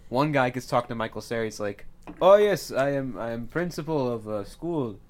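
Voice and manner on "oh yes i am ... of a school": put-on failed Swedish accent coming out Russian; botched Swedish that sounds Russian; Russian-sounding accent. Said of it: "A Russian principal of a Swedish school".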